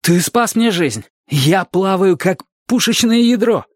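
high-pitched grateful outburst; exclaiming. A narrator's reading. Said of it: "воскликнул Дик".

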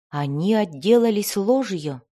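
usual speaking voice

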